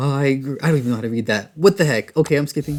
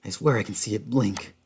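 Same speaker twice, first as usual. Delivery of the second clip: dramatic horror narration fading to a hush at the end; story narration; 4 semitones lower than usual. A narrator's reading. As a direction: build the escape, then let it sink into dread. Reads game story text aloud.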